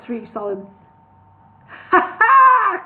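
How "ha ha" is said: high, loud triumphant ha ha; crowing laugh. A narrator's reading. Bingo crows over Solomon, loud. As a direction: crow in triumph. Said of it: "crowed Bingo".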